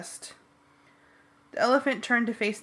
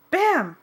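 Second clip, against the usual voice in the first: loud high bam; mock crash noise; 9 semitones higher, 7 dB louder than usual. A narrator's reading. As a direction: punch out the impact sound. Car hitting trash cans.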